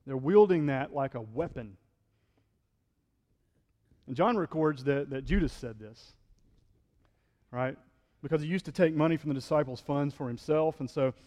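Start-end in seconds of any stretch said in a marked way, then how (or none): none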